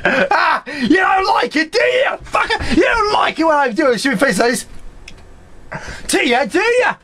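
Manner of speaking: high, strained victory jeer; crowing, high-pitched mockery; gloating taunt. Real, not acted. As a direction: taunt the beaten foe gleefully. Taunts the beaten boss.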